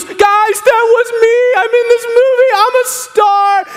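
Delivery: high, drawn-out mock-triumphant yell; hammed-up starstruck glee; ecstatic boasting. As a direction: crow it to the whole crowd. [name] runs down aisle boasting.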